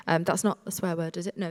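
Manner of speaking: normal talking voice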